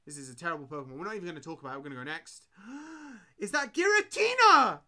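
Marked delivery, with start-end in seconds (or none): 2.5-3.3 a quick high, surprised intake of breath; sharp gasp. Real, not acted. Streamer gasping as a Giratina appears. 3.4-4.8 high, loud and slow, in disbelief; amazed. Real, not acted. Streamer seeing a Giratina in the pack, amazed.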